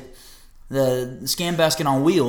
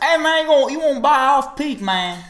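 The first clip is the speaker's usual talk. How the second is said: put-on thick New York accent, pitched high and loud; brash New York guy accent; 12 semitones higher, 4 dB louder than usual. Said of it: "you have to chill with that accent".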